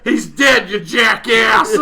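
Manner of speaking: loud, slow, laughing shout; playful shout. Real, not acted. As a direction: yell it at a friend, half laughing. Joking outburst at a friend.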